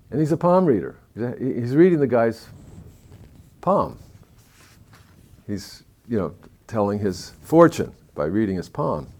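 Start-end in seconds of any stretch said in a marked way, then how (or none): none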